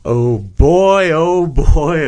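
loud, gleeful chanting; excited shout. Acted. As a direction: belt it out like a game show host. Excited show opener shout.

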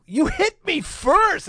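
very high, loud and slow mock protest; retold yell. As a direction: shout it back in defence. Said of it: "I'm like".

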